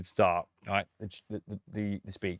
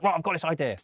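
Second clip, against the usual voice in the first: higher-pitched, eager impression of a sure artist; imitated self-assurance; 7 semitones higher, faster than usual. Producer imitating confident artists pitching ideas, self-assured. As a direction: burst in as an artist sold on their idea. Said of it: "they're so self-assured with their music".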